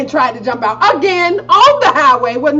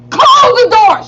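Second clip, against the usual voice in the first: loud, higher barked order; yelled command; 11 semitones higher, 8 dB louder than usual. Retold or imitated. Yelling at mom to close the car door. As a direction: shout it at her. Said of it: "I was like".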